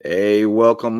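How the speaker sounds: normal talking voice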